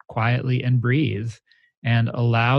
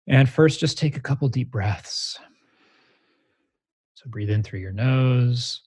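guided meditation. Shown as a demonstration. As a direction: lead a guided meditation, unhurried. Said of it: "let's meditate together right now".

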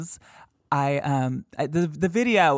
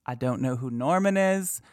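ordinary talk, nothing marked

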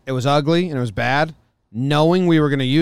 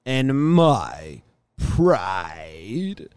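drawn-out announcement; 2 semitones higher, much slower than usual. Acted. Dramatic award announcement.

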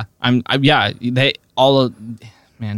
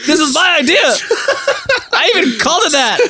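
laughing mock rant; 10 semitones higher, 3 dB louder than usual. Both mock-voicing inventors laughing.

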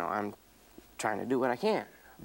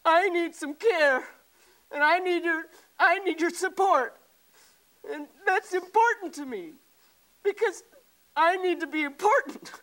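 pained pleading; 10 semitones higher than usual. Real, not acted. Husband pleading hurt to wife.

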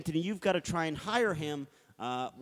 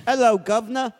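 loud, high cockney-style British greeting; hammed-up British hail; British accent; 6 semitones higher, 10 dB louder than usual. Shown as a demonstration. Host demonstrating British for co-host, hammed-up British accent. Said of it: "how to do British".

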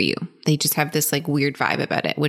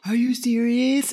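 high, drawn-out disbelieving question; mock a scandalised teenager, pitched up and slow; incredulous student; 8 semitones higher than usual. Students question teacher's whiteboard slogan, incredulous.

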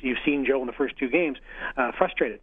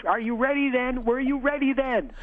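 mock taunting yell, pitched up; retold yell; 12 semitones higher, slower than usual. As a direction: jeer it across the ice, high. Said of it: "I'm yelling across".

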